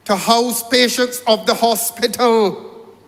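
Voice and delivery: ordinary talk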